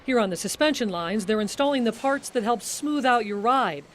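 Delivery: usual speaking voice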